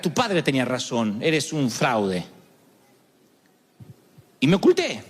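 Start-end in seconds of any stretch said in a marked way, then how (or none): 0.0-2.3 low, slow accusing voice; imagined accuser. Retold or imitated. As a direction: drop low and drag it out like a cold verdict. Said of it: "que dijeras".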